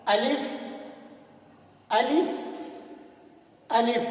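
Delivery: अलिफ evenly, short vowels, small lift; lovingly said alif. Shown as a demonstration. Teacher leading learners' practice, अलिफ said carefully, unstretched. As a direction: say अलिफ carefully without stretching. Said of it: "try करें, कोशिश करें".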